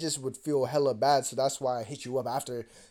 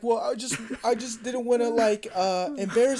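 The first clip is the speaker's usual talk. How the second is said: high-pitched imitation of her stammered excuse; mock sheepish girlfriend; 9 semitones higher, 4 dB louder than usual. Girlfriend excusing herself to him, mock sheepish.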